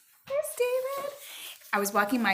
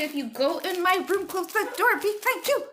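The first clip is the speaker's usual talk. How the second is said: higher, louder, fed-up reminder; raised weary-mom scolding; exasperated; 11 semitones higher, 5 dB louder than usual. Real, not acted. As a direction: vent the daily nagging. Exasperated mom repeating rule.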